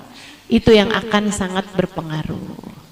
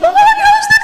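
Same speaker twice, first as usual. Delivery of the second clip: mock tearful plea; 4 dB louder, much faster than usual. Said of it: "Sambil nangis".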